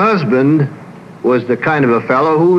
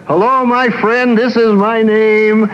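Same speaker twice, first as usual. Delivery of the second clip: higher, hearty glad-hander introducing himself; backslapping greeter; 8 semitones higher than usual. Retold or imitated. Husband greeting passengers on deck, mock glad-hander voice. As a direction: lightly imitate the extrovert husband meeting strangers. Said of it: "the same old hand-shaking, back-slapping".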